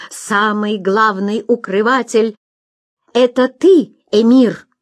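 slow, firm build to a loud ringing accusation. Acted. Nasreddin to the emir before the crowd, firm and ringing. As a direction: hurl the accusation straight at him. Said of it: "сказал твёрдым, звонким голосом".

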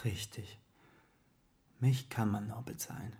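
very soft, muted echo; subdued admission. Acted. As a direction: take the insult in almost under your breath. Verräter nimmt die Verachtung hin. (sehr leise, geknickt)

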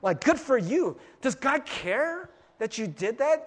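ordinary talk, nothing marked

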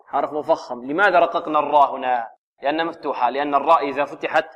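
ordinary talk, nothing marked

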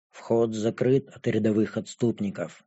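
low, hushed, detached voice; impassive dragon girl. A narrator's reading. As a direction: confide it quietly, without emotion.

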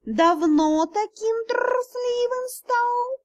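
high, very slow jeering cat; cat spirit mocking the cowardly officer. Cat taunts.

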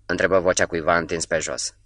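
ordinary delivery, nothing marked

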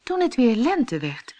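plain reading voice

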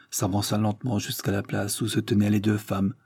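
ordinary delivery

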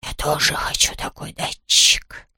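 hurt little whisper; offended whisper. A narrator's reading. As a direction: whisper it, sulking. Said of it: "обиделся Хуга".

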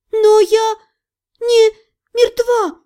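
high, strained protest in fragments; halting, high-pitched croak while being strangled; choked out. A narrator's reading. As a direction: force each word out with last strength. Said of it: "прохрипела я из последних сил".

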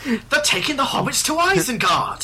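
high, hammed-up elf cry; Legolas. Host joins the impression game, high dramatic elf. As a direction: announce it like an alarmed elf. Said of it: "I'll do my Legolas impression".

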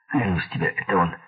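usual speaking voice

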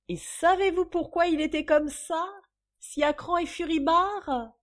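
high, loud teasing question; gleeful build-up. Acted. Mistress about to reveal her trick, gleeful. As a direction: tease the secret out loudly.

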